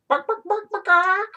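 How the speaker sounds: very high yappy barks; mock dog barks. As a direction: yap like a little dog. Barking at the pet.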